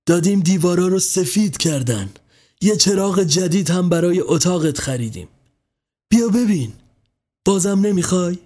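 coaxing mother. A narrator's reading.

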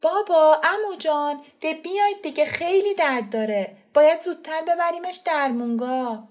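loud, high urgent shout. A narrator's reading. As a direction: yell for them to hurry. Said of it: "فرشته داد زد".

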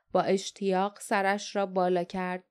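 plain reading voice

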